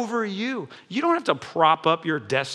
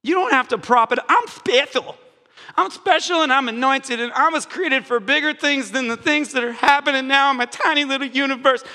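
mock proud believer; 6 semitones higher than usual. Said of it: "voicing a proud believer".

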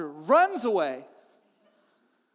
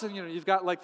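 ordinary talk, nothing marked

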